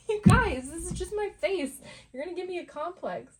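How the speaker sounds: high, loud, giggly exasperation; laughing plea. Real, not acted.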